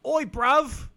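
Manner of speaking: loud, high Cockney shout; British accent. Pretend. Mate mocking Eggsy in a loud, high British accent. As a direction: bark it like a London lad. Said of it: "I can't do a British accent like that".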